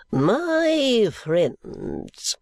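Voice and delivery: high, slow, unctuous sermonising; oily preacher voice, drawn out; pompous preacher. Acted. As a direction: play the greasy self-satisfied preacher. Preacher Chadband speaks.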